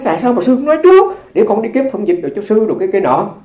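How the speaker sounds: imitating the driver's eager concern. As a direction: voice the driver's rush to help, high and loud. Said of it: "Cái nói".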